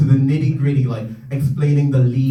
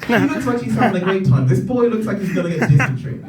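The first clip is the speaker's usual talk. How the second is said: mock wistful viewer; 6 semitones higher, faster than usual. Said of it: "I always watch these adverts like".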